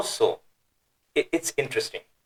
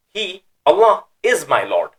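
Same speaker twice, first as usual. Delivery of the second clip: HE and ALLAH raised high and loud, falling on "is my Lord"; emphatic exclamation; 6 semitones higher, 5 dB louder than usual. Shown as a demonstration. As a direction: proclaim He, Allah with force. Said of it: "Exclamation mark".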